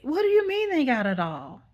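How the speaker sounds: high question sliding downward; imitated incredulous woman; confused caller. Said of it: "She was confused".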